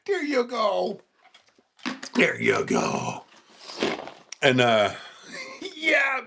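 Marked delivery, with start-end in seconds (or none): 0.1-1.0 high, light reassurance; high dog praise. Real, not acted. Two takes to dog. 2.1-3.2 low, slow and soothing; deep slow dog talk. Real, not acted.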